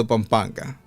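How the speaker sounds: plain reading voice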